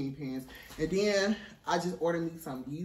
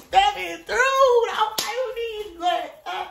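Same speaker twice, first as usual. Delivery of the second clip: high, loud sassy diva voice; runway diva hype; 13 semitones higher, 8 dB louder, slower than usual. Pretend. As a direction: strut it like a runway queen. Strutting runway show, hyping outfit.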